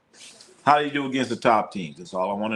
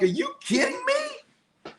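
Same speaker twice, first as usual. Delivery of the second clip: high, slow squeak of disbelief; imitating himself yelling at the TV, high and drawn out; mock outrage; slower than usual. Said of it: "I'm like".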